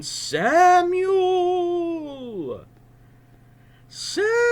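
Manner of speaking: very high, loud drawn-out call; distant heavenly call; calling voice of God. Acted. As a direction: ring out his name from afar. God's voice calls again.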